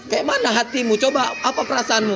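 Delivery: high, exaggerated questioning reproach; laughing through a pretend telling-off; mock scolding. Shown as a demonstration. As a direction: imitate grilling a small child. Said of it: "kita marahi anak T K".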